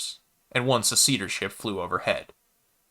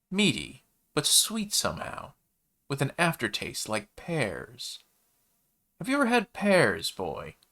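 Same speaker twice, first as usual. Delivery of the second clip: savoring food; 6 semitones higher than usual. A narrator's reading. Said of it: "swished it around in his mouth like it was wine".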